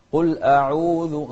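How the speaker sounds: loud, slow "قل أعوذ" with an open oral long u; waw from the jawf. Shown as a demonstration. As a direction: let the long u of "قل أعوذ" flow freely from the mouth cavity. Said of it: "انطلع من الجوف". (مد الواو من الجوف في "قل أعوذ")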